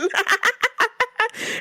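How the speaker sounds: a burst of high shrieking laughter; high-pitched laugh. Real, not acted. Cracking up at a meme of pelvic thrusts, high laugh. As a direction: crack up hard at the memory.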